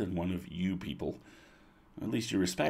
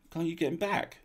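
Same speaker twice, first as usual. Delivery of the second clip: higher, pleading question; high childlike plea; Spindle; 10 semitones higher than usual. A narrator's reading. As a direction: ask it in a high hopeful voice. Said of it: "asked Spindle".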